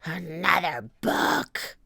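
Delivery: slow, disgusted pirate growl; drawn-out pirate grumble; Captain Bones. A narrator's reading. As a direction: drag it out in villainous disgust. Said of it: "roared Captain Bones".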